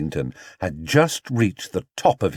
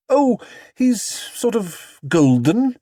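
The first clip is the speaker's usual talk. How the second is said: higher, slow and fond; musing, higher mother's voice; Mrs Brown voice; 11 semitones higher, slower than usual. Acted. Mother describing her bear, higher and slow.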